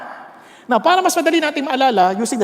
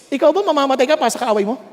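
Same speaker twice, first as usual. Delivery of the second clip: ordinary talk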